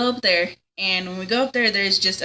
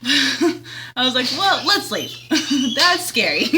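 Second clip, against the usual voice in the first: laughing; 4 semitones higher, slower than usual. Real, not acted. Laughing while telling her scary story.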